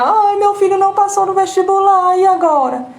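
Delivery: exaggerated moaning lament; whiny mock lament. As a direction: overplay the drama of a perpetual victim. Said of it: "Aí o outro filho não passa".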